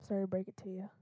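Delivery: unvoiced teasing whisper; whispered aside. Real, not acted. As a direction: whisper it as a tease.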